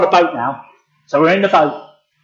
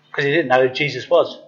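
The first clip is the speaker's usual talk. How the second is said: ordinary delivery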